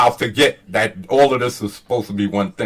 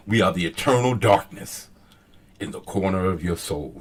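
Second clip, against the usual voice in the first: low voice sinking softer to the end; felt, emphatic recital; 7 semitones lower, 7 dB quieter than usual. Shown as a demonstration. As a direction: make them feel "darkness", then hush for the corner of your soul. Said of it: "you want them to feel that".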